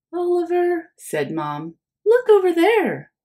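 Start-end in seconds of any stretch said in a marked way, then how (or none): 0.1-0.9 high sing-song call of his name; calling mom. Acted. Mom calling Oliver in the supermarket, high-pitched. 2.0-3.1 high, louder mom voice; eager mom. Acted. Mom showing Oliver the fruit stand, high and loud.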